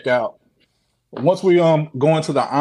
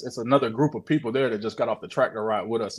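ordinary talk

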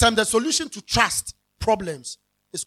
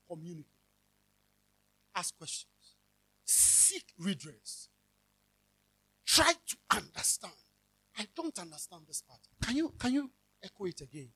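normal talking voice

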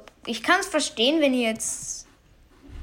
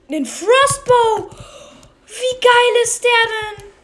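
high, slow, thrilled exclamation; excited; 9 semitones higher, 4 dB louder, slower than usual. Real, not acted. Gamer unboxing a rare bow, thrilled and high. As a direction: savour the rare find with glee.

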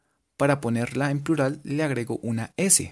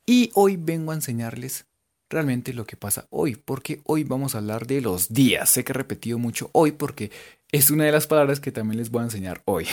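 ordinary talk

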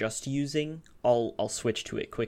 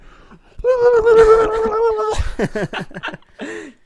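high, loud gobbling like a turkey; turkey gobble impression; 15 dB louder than usual. Shown as a demonstration. Friend trying out a turkey impression for the group, loud high gobbling. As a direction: let out a big rapid turkey gobble. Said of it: "Let me try to do what Jonathan did".

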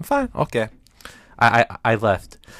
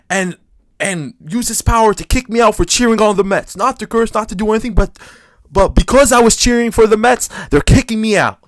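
indignant complaint; 8 semitones higher, 6 dB louder than usual. Retold or imitated. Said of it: "and I'm like".

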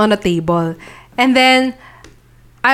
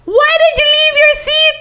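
much higher tipsy screech; mock scream; 3 dB louder than usual. Screaming at the friend who left her seat, tipsy wail. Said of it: "screaming at her".

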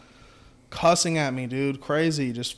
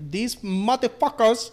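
pitched-up, slow mock cussing; mock angry owner; 7 semitones higher, slower than usual. Owner cursing drivers to the boss, slow mock anger. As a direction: spit it out like the furious owner. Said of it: "she's, like, pissed".

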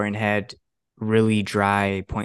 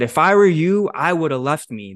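cheeky comeback; 4 semitones higher, 5 dB louder than usual. Retold or imitated. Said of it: "That's exactly what I said to her".

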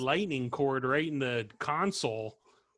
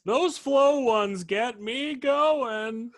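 mock old man; 9 semitones higher, 4 dB louder, much slower than usual. Mock old man continuing joke.

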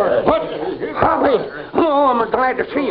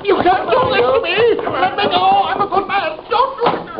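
high, desperate begging as they take him away; panicked pleading; 11 semitones higher than usual. Acted. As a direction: plead for your life, voice climbing high. Sidwell pleads as he's arrested.

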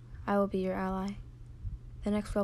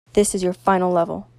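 loud, commanding mystery voice; much louder, imposing announcement; deep voice; 12 dB louder than usual. A narrator's reading. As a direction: boom it out as the unseen controller. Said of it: "said the deep voice".

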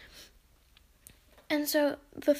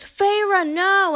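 high, loud cry of despair; shouting in horror as she's killed; anguished shout; 6 semitones higher, 9 dB louder than usual. Retold or imitated. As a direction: yell her name as she falls. Rhys reacts to Feyre's death.

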